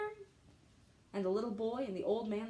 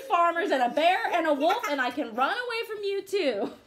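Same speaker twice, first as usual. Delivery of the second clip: loud, higher yelling; calling out; 7 semitones higher, 9 dB louder than usual. Acted. Gingerbread man yelling to the fox, loud and high. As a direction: shout it so the fox can hear. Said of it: "called out".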